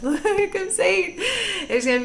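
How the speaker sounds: high, loud laughing aside. Real, not acted. Delighted laugh mid-reading.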